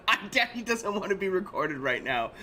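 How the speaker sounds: high-pitched strained parental protest; retold anger. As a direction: re-enact an upset dad scolding his kid. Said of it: "Like, I'm so mad".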